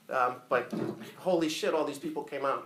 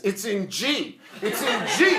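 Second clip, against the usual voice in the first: high, loud correction to the guitarist; excited high-pitched shout; insistent shout; 7 semitones higher, 5 dB louder, slower than usual. Retold or imitated. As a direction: call out the key over the band.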